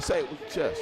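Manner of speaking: lightly voiced drill command; quoting Captain Hart. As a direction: say it like a captain giving an order. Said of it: "Captain Hart said".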